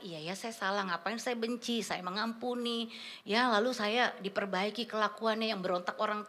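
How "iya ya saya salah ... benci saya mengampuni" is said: quiet, slow surrender; soft confession. Retold or imitated. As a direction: hush it like a confession. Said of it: "menyatakan kesalahan". (pengakuan lembut dan pelan)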